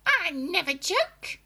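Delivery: put-on high, loud, slow old hag; stern witch denying it; witchy grandma voice. A narrator's reading. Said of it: "she said".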